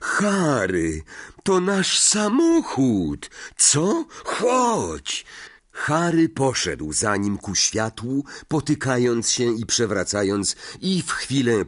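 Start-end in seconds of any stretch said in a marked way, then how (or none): 0.0-5.2 much higher, breaking with relief; a boy who just found his lost car, thrilled; relieved excitement. Acted. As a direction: blurt it out, overjoyed and shaky. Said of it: "głos załamał mu się nagłą ulgą".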